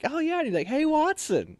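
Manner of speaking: high-pitched, imitated buddy greeting; casual officer chat. Officer chatting casually with trainee, imitated chummy greeting. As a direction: play the officer chatting like bros. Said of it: "talk casually between each other".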